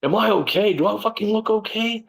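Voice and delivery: high, outraged yelling back in disbelief; incredulous angry outburst. Retold or imitated. Retelling how he snapped at the warden, outraged high yell. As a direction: hurl the question back, furious and shrill-high.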